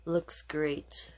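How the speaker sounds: usual speaking voice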